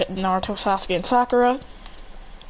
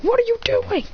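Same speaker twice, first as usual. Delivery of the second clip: very high, strained mock yell; hammed-up high-pitched exclamation; battle shout; 14 semitones higher than usual. Calls out angrily during fight.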